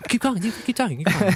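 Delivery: squeaky high, absent-minded urging; high distracted urging. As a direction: rush them on in a high, distracted voice. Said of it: "I'll do it to you".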